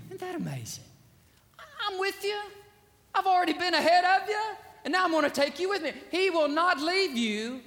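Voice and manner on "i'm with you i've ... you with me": voicing God. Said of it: "voicing God".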